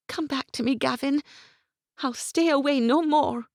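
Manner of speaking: put-on high, anxious young woman, shaken; young woman's voice. A narrator's reading. Isabel pleading with Gavin.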